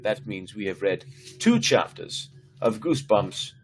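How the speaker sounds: plain reading voice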